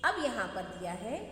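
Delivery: ordinary talk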